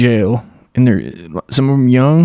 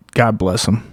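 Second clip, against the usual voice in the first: normal talking voice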